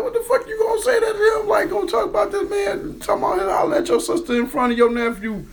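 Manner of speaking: high-pitched, incredulous telling-off; retold indignant scolding. Man retelling the group scolding a tactless friend, indignant high rant. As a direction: scold him high and disbelieving.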